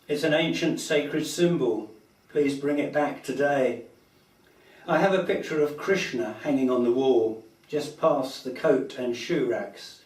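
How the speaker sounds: projected verse, line by line with pauses; rhyming poem recitation. Acted. Poet reads a rhyming poem about an ancient symbol, recited. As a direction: declaim the title, then recite in measured lines.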